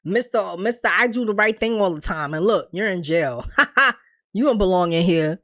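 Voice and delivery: teasing jibe with a mocking "ha"; joking taunt. Visitor mocking do-gooder now in jail, joking. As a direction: imitate someone gleefully ribbing a friend. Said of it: "Almost like a joke".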